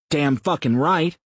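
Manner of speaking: a foul-mouthed blowhard pitching an idea; crude loud man. A narrator's reading. Mouth pitches a rally to Sam, loud brash rough voice.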